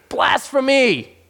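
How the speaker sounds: high, slow outraged cry of Blasphemy. Retold or imitated. Council condemning Jesus, outraged cry. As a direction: cry it out as an accusation.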